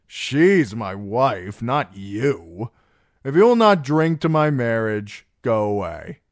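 loud, boozy rebuff; tipsy, emphatic dismissal; defiant drunken singer. Acted. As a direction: send a nagging friend packing. Plays Darion dismissing Sam.

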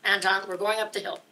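firm mock command. Stopping Anton, retold.

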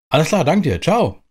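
ordinary delivery

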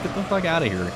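ordinary talk

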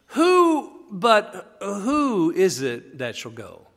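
high, loud, drawn-out WHO, then slowing; Pharaoh having second thoughts. Acted. Pharaoh stopping short over who's leaving, sudden querying. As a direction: interrupt yourself with a stretched, high question. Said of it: "he immediately thinks, "Wait a minute".

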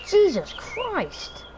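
exasperated. Real, not acted. Exasperated at friend's memes.

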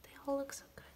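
breathless whispered oh my gosh; hushed, starstruck whisper; awed whisper. Real, not acted. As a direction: whisper it in disbelief. Whispering over the photos.

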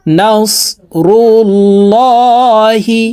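নাসরুল্লাহি chanted with a heavy "law", held long; thick lam. Shown as a demonstration. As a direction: round and darken the doubled L in নাসরুল্লাহি. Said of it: "এই লামকে ল বলে পড়ব".

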